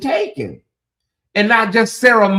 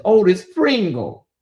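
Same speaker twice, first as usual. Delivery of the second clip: normal talking voice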